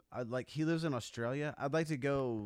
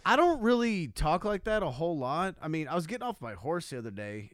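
ordinary talk

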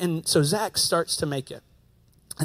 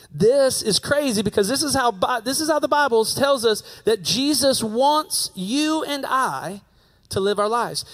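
higher, fervent sermon build; preaching; 5 semitones higher than usual. Acted. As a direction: preach it out with rising conviction. Preaching builds to full force.

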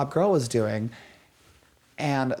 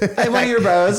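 higher, louder teasing echo; 7 semitones higher, 6 dB louder than usual. Real, not acted. Ribbing her co-host about her bros, teasing. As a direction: poke fun at the phrase. Teasing repeat of friend's words.

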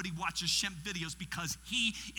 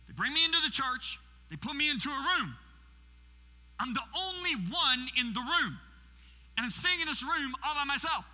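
loud, high, emphatic storytelling; forceful preaching; 9 semitones higher, 8 dB louder than usual. Acted. Guest preacher telling how a church left him alone, forceful preaching. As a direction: preach it out with mounting exasperation.

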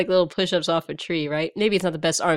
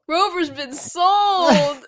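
high, loud, drawn-out dismay; 9 semitones higher, 3 dB louder, much slower than usual. Retold or imitated. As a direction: wail it heartbroken. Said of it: "He's like".

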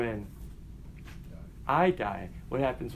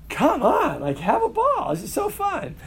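much higher, gushing cheer; excited urging; 13 semitones higher, 5 dB louder than usual. Real, not acted. Teacher urging students to enjoy the assignment, gushing. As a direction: sell the fun of it to the class.

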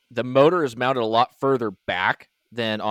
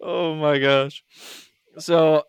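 laughing; 5 semitones higher than usual. Real, not acted. Embarrassed after being teased.

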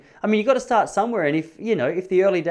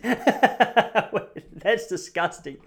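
laughing; 7 semitones higher, much slower than usual. Real, not acted. Laughs at own joke.

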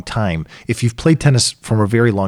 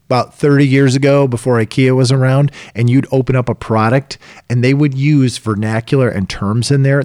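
ordinary delivery

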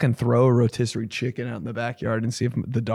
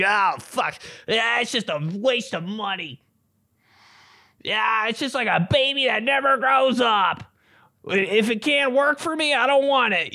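high, strained mock-dad complaint; grumpy anti-dog dad; 10 semitones higher than usual. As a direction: put on a whining dad who hates dogs. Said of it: "a lot of dads are like".

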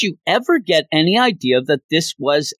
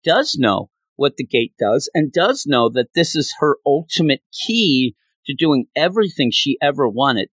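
ordinary delivery

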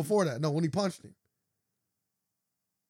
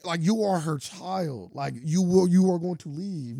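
usual speaking voice

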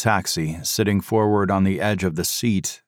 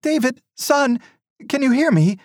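high, raised calling voice; mother calls into the darkness for her son, raised voice; 4 dB louder than usual. A narrator's reading. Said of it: "She raised her voice".

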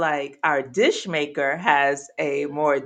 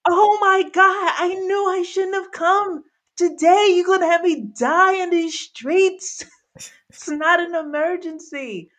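exasperated outburst; 12 semitones higher than usual. Retold or imitated. To herself in the storm, a high exasperated rant. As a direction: complain high and fast-rising about being caught in the rain.

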